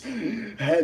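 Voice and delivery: normal talking voice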